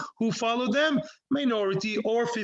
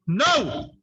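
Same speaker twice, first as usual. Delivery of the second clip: sharp, loud no, higher; angry no; 4 semitones higher, 4 dB louder than usual. Real, not acted. Rabbi teaching on YouTube gets angry at a reading, loud no. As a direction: cut the argument off with a loud, angry no. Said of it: "I'm getting angry on YouTube".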